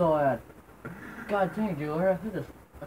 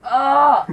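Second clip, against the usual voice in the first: pain yell; 8 semitones higher, 11 dB louder than usual. Real, not acted. Pain while stripping face.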